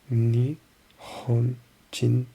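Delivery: very slow Nihonjin, each sound stretched; drawn-out practice. Shown as a demonstration. Learner slowly sounding out the app word. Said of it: "Nihonjin desu".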